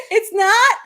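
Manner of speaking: high, loud, laughing wail; laughing mock despair. Retelling her reaction to her sick son, laughing. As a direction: ham up a mother's dismay. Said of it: "I said".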